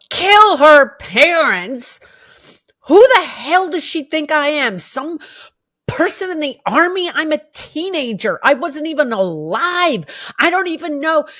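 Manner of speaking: loud, high, outraged protest; outraged shouting. Retold or imitated. A teenager shouting back at a stranger's accusation, outraged. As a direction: yell it back at the stranger in disbelief. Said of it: "I just go".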